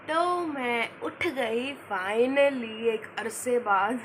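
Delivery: usual speaking voice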